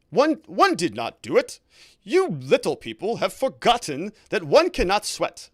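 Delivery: put-on high, slow posh English accent; loud, drawn-out mock posh English. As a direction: do a hammed-up royal posh English voice. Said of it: "best posh English accent".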